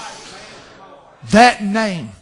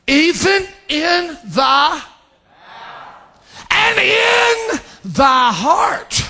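high, very slow, stretched scripture; fervent preaching cry; 8 semitones higher, much slower than usual. Real, not acted. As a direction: draw each word out in a high cry.

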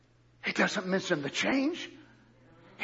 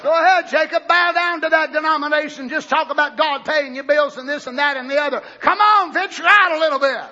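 loud, high exhortation; calling out; 6 semitones higher, 7 dB louder than usual. Real, not acted. Preacher daring Jacob to venture out, loud. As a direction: challenge him loudly.

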